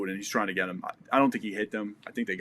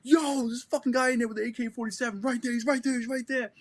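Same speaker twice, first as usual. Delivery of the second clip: much higher, urgent urging; urgent pointing out; 12 semitones higher than usual. Retold or imitated. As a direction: point him out, hurried and insistent. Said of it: "and I'm like".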